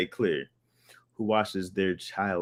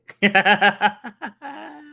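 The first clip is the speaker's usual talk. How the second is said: high burst of real laughter; 12 semitones higher, 7 dB louder than usual. Real, not acted. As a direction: crack up loudly. Laughing at the song memory.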